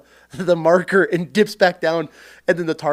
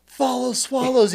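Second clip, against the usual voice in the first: recruit calling out; 8 semitones higher than usual. Retold or imitated.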